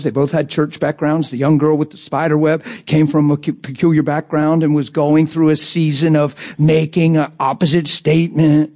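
ordinary talk, nothing marked

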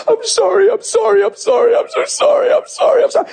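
high-pitched tearful repeated sorry; acting out a wife crying hard; imitated crying apology. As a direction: blurt it over and over as if sobbing. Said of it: "she's crying so hard".

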